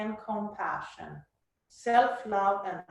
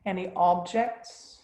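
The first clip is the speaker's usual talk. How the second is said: soft spa whisper. Acted. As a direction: soothe them into stillness, unhurried. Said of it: "let's move into the guided meditation".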